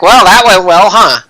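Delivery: upbeat. Acted. NPC Stryker's cheerful quip.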